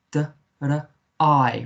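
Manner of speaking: "D, r, I" as separate phonics sounds; short "D", short "r", open "I" as eye; sounded out. Shown as a demonstration. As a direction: split drei into "D, r, I". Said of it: "so it's the I sound".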